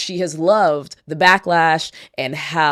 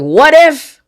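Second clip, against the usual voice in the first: much higher and louder hopeful what if; excited what if; 11 semitones higher, 10 dB louder than usual. Retold or imitated. Host retelling her hopeful thought, excited. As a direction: burst out with wishful hope. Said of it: "I was like".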